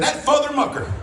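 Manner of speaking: hammed-up road rage spoonerism; a mother yelling at a driver who cut her off; mom cursing in traffic. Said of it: "She said".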